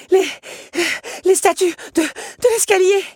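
high, loud, out-of-breath cry; breathless alarm. Acted. Belle, out of breath, warns of the lions in alarm. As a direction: gasp it out in terror, high and loud. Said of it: "tout essoufflée".

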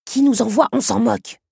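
high, loud, impatient brush-off; emphatic and curt; dismissive. A narrator's reading. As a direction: wave the question away forcefully. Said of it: "affirma le scarabée".

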